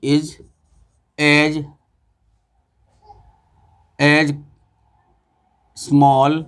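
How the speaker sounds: each word spaced out with long pauses; slow dictation. Shown as a demonstration. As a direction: dictate it word by word for students to copy. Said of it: "teacher dictating the board example".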